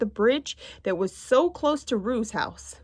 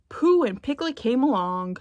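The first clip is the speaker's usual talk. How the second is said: usual speaking voice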